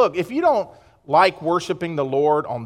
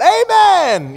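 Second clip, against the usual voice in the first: high, loud congregational shout; imitating a crowd's joyful yell; yelled amen; 10 semitones higher, 5 dB louder than usual. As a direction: yell it out, drawn long. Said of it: "they like to yell out".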